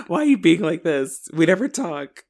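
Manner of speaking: hammed-up exasperated plea; mock frustration. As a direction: act out a fed-up kid's complaint. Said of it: "would have been more frustrated".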